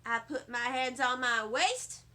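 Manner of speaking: ordinary delivery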